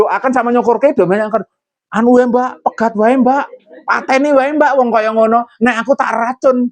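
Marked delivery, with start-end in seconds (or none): 1.9-6.7 egging-on friends. Retold or imitated. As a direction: stir her up like a gossiping neighbour. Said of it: "gitu loh ya".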